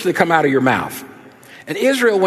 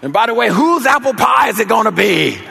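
put-on loud wail of kids' alarm; mock dismay; 3 semitones higher, 4 dB louder than usual. Kids realizing the neighbor takes their pie, loud mock dismay. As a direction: cry out in hammed-up horror, rising higher. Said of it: "And then we thought".

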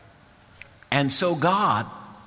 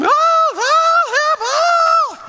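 very high, loud mock scream; screaming for help; 7 dB louder, much slower than usual. Old lady thinks she's being robbed, hollering scream. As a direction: shriek it like a panicked old lady. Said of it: "she started hollering, screaming".

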